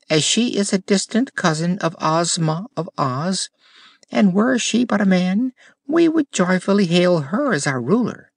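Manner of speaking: high, fluting gardener voice; high voiced gardener explaining. A narrator's reading. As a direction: speak up proudly for the princess. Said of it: "the gardener".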